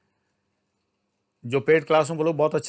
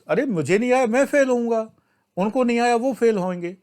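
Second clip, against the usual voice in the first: higher, mock-anxious student whine; mock panicky student; 7 semitones higher than usual. Teacher mimicking a student worried about failing, high mock fret. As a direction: imitate a fretting student, pitched up.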